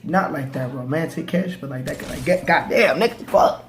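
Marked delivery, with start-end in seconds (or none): 2.2-3.6 imitating a friend's loud, strained outburst; startled cursing. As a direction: curse as if suddenly catching someone falling. Acting out friend catching him falling.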